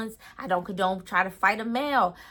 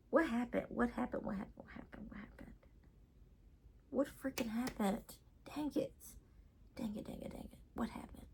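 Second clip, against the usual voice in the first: muttering to herself; 15 dB quieter than usual. Real, not acted. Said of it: "I'm trying to think".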